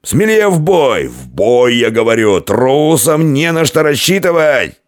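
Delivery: high, drawn-out commander's cry; rally the troops at a drunken bellow; roaring, slow war call; battle shouting. A narrator's reading. Drunk warrior shouting battle calls.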